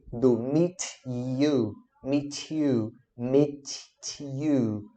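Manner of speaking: slow 'meet ... you' with the words held apart; slow, separated. Shown as a demonstration. Teacher showing a beginner's pauses, slow and separated. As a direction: stretch 'meet' and 'you', pausing between them. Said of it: "a gente começa a pausar".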